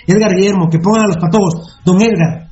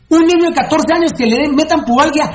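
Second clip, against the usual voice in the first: raised, emphatic rant; 6 semitones higher than usual. Real, not acted. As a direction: hammer the point home.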